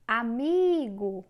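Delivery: aMIIIgo, slow with MI stretched loud; slow Amigo leaning hard on MI; calling out, stressed syllable. Shown as a demonstration. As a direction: call out Amigo, swelling on the middle syllable. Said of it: "como é que você descobre".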